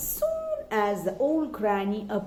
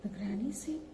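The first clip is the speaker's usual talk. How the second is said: ordinary delivery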